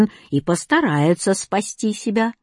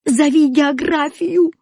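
high, drawn-out yell; 7 semitones higher, 4 dB louder, slower than usual. A narrator's reading. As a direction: yell it in alarm, voice pitched up. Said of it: "завопил Кузя". (отчаянный вопль)

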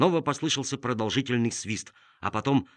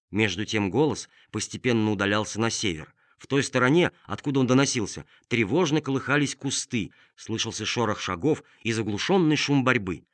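ordinary delivery

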